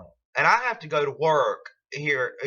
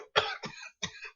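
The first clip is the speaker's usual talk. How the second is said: cough; 8 dB quieter than usual. Real, not acted. Sick speaker coughs.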